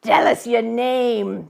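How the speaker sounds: put-on a convict interrogating the boy; gruff convict demand. A narrator's reading.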